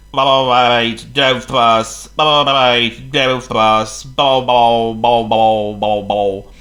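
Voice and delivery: grumbling trucks. Acted. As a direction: grumble in a rhythm like wheels. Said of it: "the trucks grumbled".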